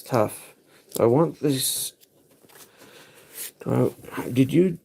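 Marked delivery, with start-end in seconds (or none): none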